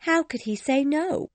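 high, amused lilt; a touch of laughter in the question; laughing question. A narrator's reading.

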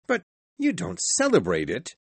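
high-pitched earnest objection; protesting nephew. A narrator's reading. Nephew protests to his uncle.

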